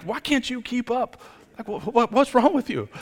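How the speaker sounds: high, squeaky mock exasperation; mock impatience. Husband teasing himself for nagging his wife on the bike, high mock whine. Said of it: "And I'm like".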